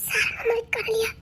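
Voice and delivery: soft, slow, crying question; tearful whisper. Acted. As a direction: barely get the words out through tears. Hears her relative is missing. (அழுகையுடன் மெதுவாக தாழ்ந்த குரலில் கேட்பது)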